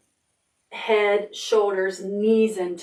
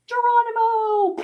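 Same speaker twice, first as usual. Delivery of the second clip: geronimo yell; 14 semitones higher than usual. Acted. Pig cannonballing into the tub, gleeful high yell. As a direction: leap in with a whoop. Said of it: "He's like".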